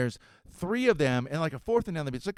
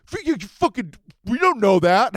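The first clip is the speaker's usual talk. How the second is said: put-on flustered teen; 10 semitones higher, 11 dB louder than usual. Surf kid stammering at him.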